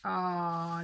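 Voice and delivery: ordinary talk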